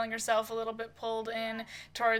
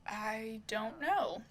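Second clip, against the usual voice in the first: slow, weary admission trailing softer; sighing resignation; 5 dB quieter, slower than usual. Acted. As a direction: let it out slowly like a sigh. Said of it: "Chris just kind of sighs".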